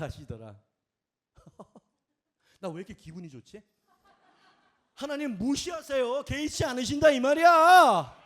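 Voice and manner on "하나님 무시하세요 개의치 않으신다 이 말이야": high, loud preaching cry; rousing proclamation, swelling at the end; exultant shout. Real, not acted. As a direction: build to a drawn-out full shout. Preacher exclaims gleefully to congregation.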